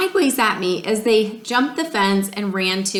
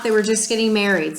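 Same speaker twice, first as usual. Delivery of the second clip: usual speaking voice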